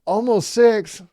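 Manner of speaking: put-on child's voice. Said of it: "How old are you?".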